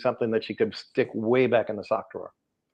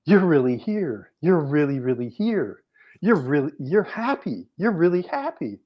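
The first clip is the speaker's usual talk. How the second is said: lightly voiced, slow, higher and louder; a hint of a tearful daughter; 5 semitones higher, 4 dB louder than usual. Daughter overjoyed to see her mother, lightly voiced. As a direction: lightly play the daughter's overwhelmed joy.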